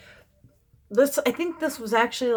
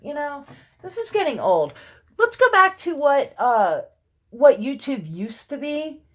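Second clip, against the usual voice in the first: loud, raised-pitch complaint; worked-up venting; 5 semitones higher, 7 dB louder than usual. Real, not acted. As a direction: air your frustration forcefully. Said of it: "I had to vent".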